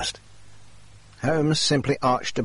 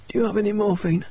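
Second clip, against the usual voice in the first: a much higher, pained voice for the injured Jacob; injured man's voice; 10 semitones higher, faster than usual. Acted. Injured Jacob pleading with the doctor, higher pitched voice. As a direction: voice Jacob in strained higher register. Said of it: "Jacob said".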